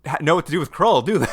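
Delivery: mock the writers, sarcastically; sarcastic. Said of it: "I was like".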